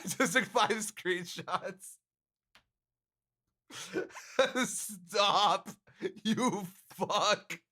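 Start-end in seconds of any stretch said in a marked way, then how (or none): 3.7-4.6 giggle fit. Real, not acted. Laughing at screenshots. 4.7-7.6 higher, giggly mock outrage; play-scolding chat through laughter; teasing, laughing "stop"; laughing protest. Real, not acted. Playful protest while laughing.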